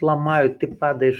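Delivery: ordinary talk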